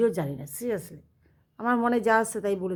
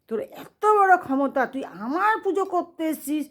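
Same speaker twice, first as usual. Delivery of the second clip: god's angry scolding; 7 semitones higher than usual. Retold or imitated. God scolding a cheating priest.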